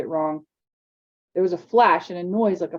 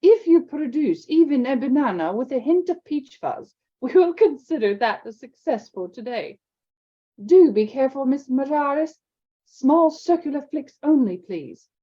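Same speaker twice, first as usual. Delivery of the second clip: higher, louder stern headmistress; strict Scottish professor raising her voice; McGonagall calling out; 7 semitones higher, 4 dB louder than usual. Acted. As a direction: call over the noisy class, brisk and precise. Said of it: "she called over the noise".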